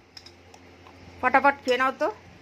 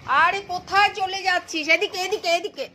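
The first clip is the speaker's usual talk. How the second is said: calling out; 8 semitones higher, 3 dB louder than usual. Real, not acted. Calling wandering dog back.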